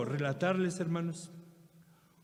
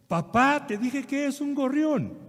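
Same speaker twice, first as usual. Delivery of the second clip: raised, higher, louder exasperated reply; irritated son; 10 semitones higher, 7 dB louder, slower than usual. Retold or imitated. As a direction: lose patience with the old man. Son irritated, third answer.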